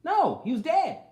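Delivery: preacher's emphatic retort. Real, not acted. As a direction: slam the answer down. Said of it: "Did it have anything to do with his faith?".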